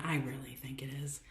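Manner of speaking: hushed confiding whisper. Real, not acted. Coach confiding to viewers about laughter, whispered. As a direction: drop to a whisper as if sharing a secret.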